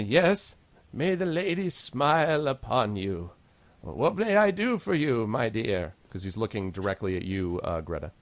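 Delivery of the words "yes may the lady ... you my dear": put-on high, slow, kindly old gnome; lilting grandmotherly character voice. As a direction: play an elderly gnome priest giving a blessing. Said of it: "your gnome voice is perfect for a grandmother".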